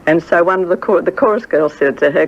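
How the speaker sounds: ordinary delivery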